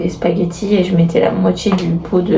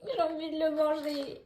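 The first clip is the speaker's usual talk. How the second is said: hushed; 7 semitones higher, 17 dB quieter than usual. Real, not acted. Baby asleep, hushed tender chat.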